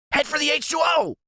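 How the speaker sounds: high shouted command. A narrator's reading. Sidekick shouting directions toward the pond. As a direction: bellow the order high.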